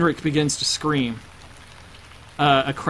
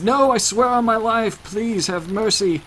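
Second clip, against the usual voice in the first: high, desperate plea for mercy; frantic high-pitched begging; tearful pleading; 8 semitones higher than usual. Acted. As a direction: beg desperately, pitch leaping up then slowing. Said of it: "And he's crying".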